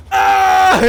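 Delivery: very high, loud terrified scream; mock scream. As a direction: shriek at the giant hornets. Screams at hornets.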